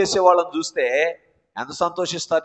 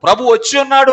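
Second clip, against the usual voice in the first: high, loud proclaiming cry; angel's cry; 8 semitones higher, 8 dB louder, faster than usual. Retold or imitated. Angel announces the coming Lord, high and loud cry. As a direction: ring it out like a herald angel. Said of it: "దూతగారు ఏమంటున్నారు".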